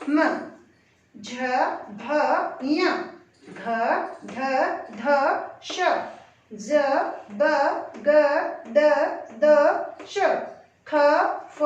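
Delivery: letters called out one by one with pauses; careful pronunciation. Shown as a demonstration. Teacher repeating the letters for students, one at a time. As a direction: spell out each sound slowly and cleanly. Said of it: "फिर से देखें एक बार".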